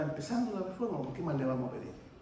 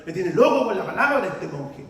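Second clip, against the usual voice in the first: queja exasperada; 3 semitones lower, 8 dB louder than usual. Retold or imitated. Queja fingida contra el monje.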